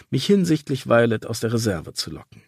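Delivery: ordinary delivery, nothing marked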